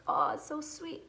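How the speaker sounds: high doting coo. Retold or imitated. Mom recalling how her kids sounded, high doting coo. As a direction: gush like melting over them. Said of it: "And I was like".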